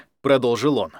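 usual speaking voice

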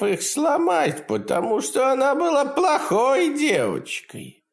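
high, gleeful imp voice; cackling hell torturer. Acted. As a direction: taunt him with cruel glee. Demon taunts.

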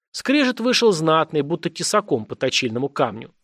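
usual speaking voice

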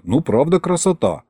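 plain reading voice

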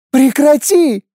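high tearful scream; angry crying. Acted. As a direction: scream through sobs. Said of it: "закричала она, захлёбываясь слезами". (крик сквозь слёзы)

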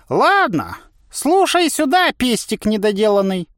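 high, insulting coach's command; gruff old trainer. A narrator's reading. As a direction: call the trainee to order in a high voice. Trainer begins lecturing the trainee. (сварливый тренер, высоко и властно)

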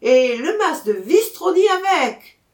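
high, loud call from the crowd; heckling shout. A narrator's reading. Someone heckles amid laughter and cheers, high loud shout. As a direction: throw it out over the laughter. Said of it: "lança quelqu'un au milieu des rires".

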